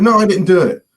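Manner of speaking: high, loud protest; protesting denial. Retold or imitated. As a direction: imitate a friend insisting he is innocent. Friend denies the prank.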